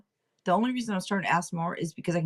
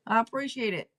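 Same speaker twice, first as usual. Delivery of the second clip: ordinary delivery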